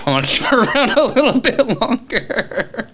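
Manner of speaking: words broken by laughter; laughing. Real, not acted. Telling a friend about his date, laughing. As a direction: giggle through the line.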